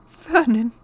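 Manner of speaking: high, loud anxious wife; quivering voice. A narrator's reading. Frightened wife to her husband, high quivering voice. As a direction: call the name with a quiver. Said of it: "saying in a quivering voice".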